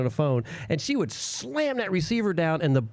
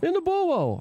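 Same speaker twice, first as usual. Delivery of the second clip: put-on high-pitched Brooklyn accent on Buo; Brooklyn accent imitation; 12 semitones higher, slower than usual. Echoing a friend's Brooklyn way of saying borough. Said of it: "that's how she talked".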